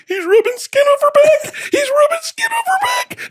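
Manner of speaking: squealing very high through hysterical laughter; laughing hysterically. Retold or imitated. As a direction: cry it out, helpless with laughter. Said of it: "my dad's going".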